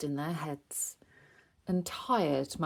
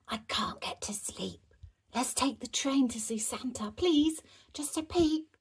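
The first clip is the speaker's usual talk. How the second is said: whispering; 5 semitones higher than usual. Acted. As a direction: whisper it, begging sweetly. Sneaking out at night.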